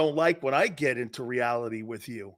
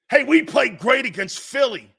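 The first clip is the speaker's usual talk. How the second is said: mock fan boast; 7 semitones higher, 5 dB louder than usual. Mocking Cowboys fan boast.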